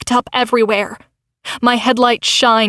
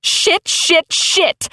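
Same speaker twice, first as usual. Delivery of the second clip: higher frustrated curses; panicked cursing; 6 semitones higher, much slower than usual. A narrator's reading. Crystal swears at the dashboard, panicked curses. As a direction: spit the words out in panic.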